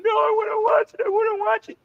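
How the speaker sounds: very high-pitched whiny child imitation; protesting kid. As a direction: act out a kid pleading with mom. Child protesting to mom in story.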